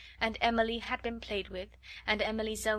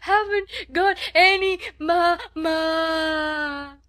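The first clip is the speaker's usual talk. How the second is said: high, loud bawling words; tempestuous child howl; 8 semitones higher, 11 dB louder, much slower than usual. A narrator's reading. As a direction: howl it like a little girl, holding the last syllable. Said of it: "Lottie howled tempestuously".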